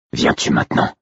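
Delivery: low, rasping whispered call; wolf companion. A narrator's reading. Wolf calling his brother in thought, rasping whisper. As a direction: rasp the question almost without voice. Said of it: "Mon propre loup qui m'appelait ainsi".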